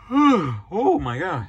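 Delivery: slow words through a yawn. Real, not acted. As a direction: let a yawn drag it out. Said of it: "Oh, I cannot stop yawning".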